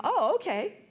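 high, sing-song acceptance; agreeable child. Retold or imitated. Children answering mom when given a choice, high agreeable voice.

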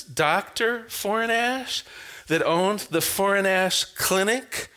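normal talking voice

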